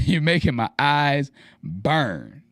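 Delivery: slow, drawn-out mock suffering; playful, lingering gripe; joking complaint. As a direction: stretch it out slowly after a laugh. Repeats the joking line after a laugh.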